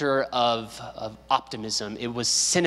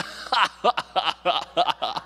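imitated infectious laugh; 3 semitones higher than usual. Preacher imitating the teacher who laughed at his own jokes, hearty laugh. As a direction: laugh like the jolly visiting teacher. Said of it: "he'd be like".

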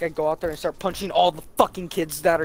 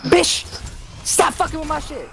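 much higher, hammed-up yell; exaggerated high-pitched scolding; mock angry yell; 12 semitones higher than usual. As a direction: yell it high, acting out exasperation. Retelling his anger at coworker.

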